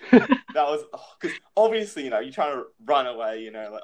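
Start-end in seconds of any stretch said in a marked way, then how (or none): none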